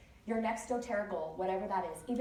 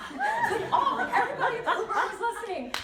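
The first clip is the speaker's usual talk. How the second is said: high, loud laughing through the words; laughing hard; 13 semitones higher, 7 dB louder than usual. Real, not acted. Telling the class about the Uber, laughing loudly and high. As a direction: crack up while telling it.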